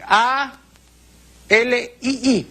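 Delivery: A L I I read out slowly, letter by letter, high; spelled letter by letter. Shown as a demonstration. Teacher spelling the Latin word for a viewer, letter by letter. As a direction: spell "A L I I" one letter at a time. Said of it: "tiene que poner et ali".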